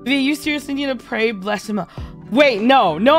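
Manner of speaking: ordinary talk, nothing marked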